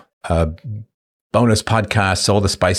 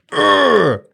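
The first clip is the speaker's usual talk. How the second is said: high, pained urgh of disgust; a high revolted groan; 14 semitones higher, 3 dB louder than usual. Pretend. Host groans at his face on a meme, high.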